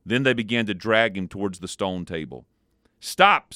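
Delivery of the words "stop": high, loud barked stop; the witch halts her followers with a loud, high stop; sharp witch command. A narrator's reading. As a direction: cut them off with one loud word. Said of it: "said the witch".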